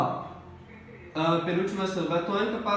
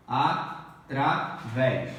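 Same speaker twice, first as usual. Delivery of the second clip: "Através" slow and lower, in separate syllables; 4 semitones lower, much slower than usual. Shown as a demonstration. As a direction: pause between the syllables of "Através". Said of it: "Faça a separação silábica". ("Através" devagar, sílaba por sílaba)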